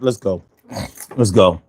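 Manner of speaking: ordinary talk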